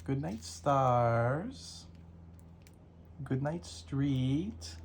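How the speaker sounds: slow, drawn-out sing-song; slow soothing bedtime read. Acted. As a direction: stretch each word like a lullaby.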